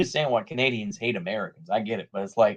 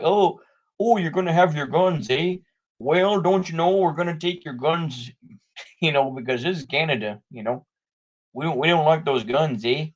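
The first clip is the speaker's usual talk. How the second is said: hammed-up Anglo-Canadian accent, pitched up; 4 semitones higher than usual. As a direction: put on a mock-folksy Canadian accent. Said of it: "that's my, uh, Canadian accent".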